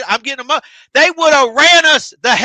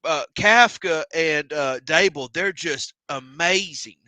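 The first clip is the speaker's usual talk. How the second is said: lower, slower, softer mock gushing; mock gushing pundit; 7 semitones lower, 4 dB quieter, slower than usual. Mocking national media praising the new coaches, fawning. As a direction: play a fawning pundit, drawn out and soft. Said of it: "they're like".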